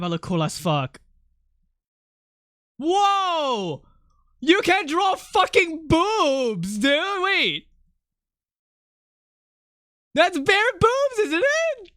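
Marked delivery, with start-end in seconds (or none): 2.8-7.7 high, drawn-out shocked objection; shocked protest. Real, not acted. Shocked at collaborator's drawing. 10.1-11.9 very high, drawn-out disbelief; shocked squeal. Real, not acted. Shocked at the drawing.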